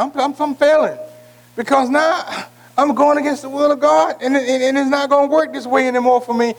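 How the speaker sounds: a hint of a frustrated sinner; lightly voiced lament. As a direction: speak as the failing man. Voices the disobedient man.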